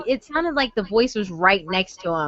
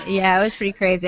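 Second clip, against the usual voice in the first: usual speaking voice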